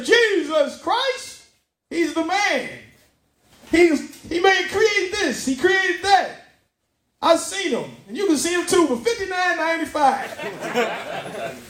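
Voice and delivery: high, slow preacher pitch; late-night TV minister selling God, high and slow. Acted. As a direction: sell salvation like an infomercial.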